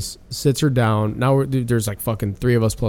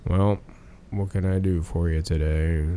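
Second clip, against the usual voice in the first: lower, softer, very slow Lurch-like drawl; lightly voiced dull slow coworker, dragging each word; slow Steve; 8 semitones lower, 8 dB quieter than usual. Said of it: "Like that, literally".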